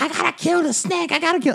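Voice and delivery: mock-frantic falsetto squeal; screaming drunk girl. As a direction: imitate a drunk girl in a high-pitched panic. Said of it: "Why are you screaming?".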